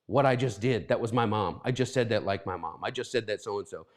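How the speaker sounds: catching yourself sounding like your mom; mock dawning realization. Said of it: "saying something like".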